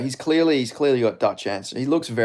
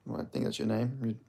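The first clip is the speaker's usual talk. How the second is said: usual speaking voice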